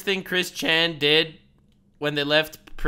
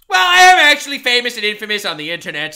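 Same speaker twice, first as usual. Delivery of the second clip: mock Chris quote; 5 semitones higher, 8 dB louder, much faster than usual. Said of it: "Let me remind you".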